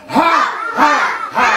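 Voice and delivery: high, loud, forceful barks; huge guard dog bark. Shown as a demonstration. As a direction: bark like a mighty watchdog. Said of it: "opravdu obrovský hlídací pes". (mohutný štěkot hlídacího psa)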